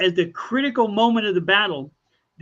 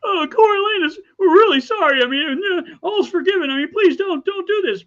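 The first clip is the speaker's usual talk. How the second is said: much higher, crying, pleading friends; tearful plea; 12 semitones higher, 5 dB louder than usual. Retold or imitated. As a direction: beg him through sobs to spare Rome. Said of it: "They go".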